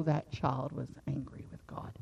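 dropped to a near whisper; hushed aside. Real, not acted. Preacher hushing the room about the angry child. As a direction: lean in and breathe it out.

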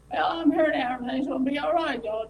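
mock cheerful, loud and quick; breezy bouncing arrival. Host mocking a carefree Jesus arriving at Lazarus' home, breezy. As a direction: breeze in like nothing's wrong. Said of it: "bouncing and saying".

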